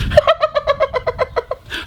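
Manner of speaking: very high bright giggle; girl's giggle. Acted. The little girl sees the bat's grin, high giggle. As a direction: burst into a helpless girlish giggle.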